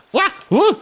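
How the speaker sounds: wild whoops. Real, not acted. Whooping at speed.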